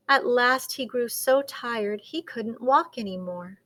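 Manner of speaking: ordinary delivery, nothing marked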